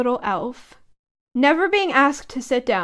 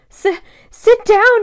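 high, loud, slow tearful wail; 9 semitones higher, 8 dB louder, slower than usual. A narrator's reading. Dobby the elf bursting into tears at Harry's kindness, loud high wail. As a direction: wail it through sobs like an overwhelmed elf. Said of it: "he wailed".

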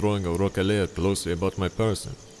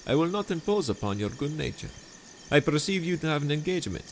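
higher, sweetly scheming host voice; honeyed charm pitched up high; Montresor, falsely friendly; 9 semitones higher than usual. Acted. Montresor dialogue.

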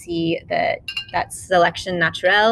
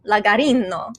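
put-on Italian accent. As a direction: roll out the grape names in Italian accent. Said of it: "in your best Italian accent".

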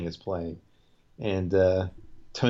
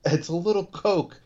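higher mock Richie, dismissive; mimicking Richie; 8 semitones higher than usual. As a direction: shrug off "little" in a pitched-up voice. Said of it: "The way he says it to him".

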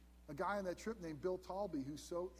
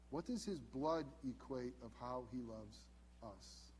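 slower, softer and lower; solemn and slow; 5 semitones lower, 5 dB quieter than usual. Real, not acted. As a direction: let it sink in slowly. Solemn question about Christ's blood.

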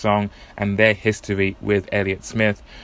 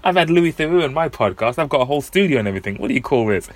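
mock-scornful higher voice; scoffing imagined Rob; 4 semitones higher than usual. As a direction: sneer at the humble phone setup. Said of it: "I bet Rob's thinking".

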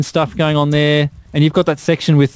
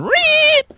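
very high squealing mock guitar note "Reet!"; guitar squeal imitation. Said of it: "this guitar going".

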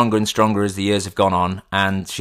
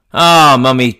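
ordinary talk, nothing marked

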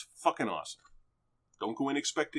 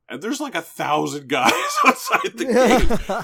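loud, slow, laughing through the words; laughing excitedly; 11 semitones higher, 15 dB louder than usual. Real, not acted. Laughing loudly while telling a friend the movie. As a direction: burst out laughing at how crazy it is.

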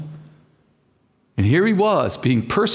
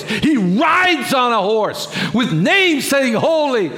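preaching scream; 6 semitones higher, slower than usual. Real, not acted. Pastor shouting about Revelation to the congregation. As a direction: shout it slow and big. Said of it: "Oh, I'm screaming".

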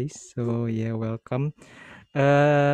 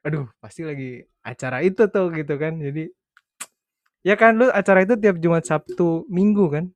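animated; 5 semitones higher, 6 dB louder, faster than usual. Real, not acted. Excited story about calling.

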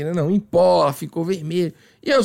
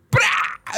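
very high, sharp tearing noise; imitated rip. Waxer tearing off the strip, imitated rip. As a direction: rip it out in one high burst. Imitates the wax being ripped.

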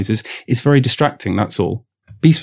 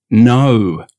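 demo no; 5 dB louder than usual. Shown as a demonstration. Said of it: "I'm gonna say no, but watch".

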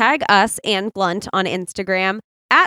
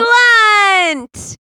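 high excited yell; cheer shout; 11 semitones higher, 5 dB louder than usual. Pretend. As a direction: belt the brand name out.

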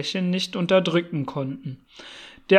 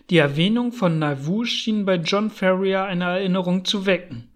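plain reading voice

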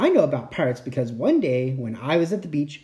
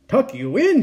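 high incredulous pirate question; mild bellow; 8 semitones higher, 3 dB louder than usual. A narrator's reading. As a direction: throw the request back, bellowing a little. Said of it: "he bellowed".

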